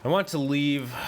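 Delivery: ordinary delivery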